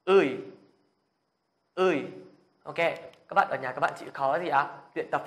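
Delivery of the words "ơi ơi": loud, forceful vowel drill; full breath. Shown as a demonstration. Teacher models vowels for Korean learners, at full breath. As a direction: push each syllable out on a big breath. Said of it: "đừng tiết kiệm sức của mình quá".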